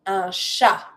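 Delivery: "chat" ending on an open "sha", no T; silent final T. Shown as a demonstration. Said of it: "Don't pronounce the T".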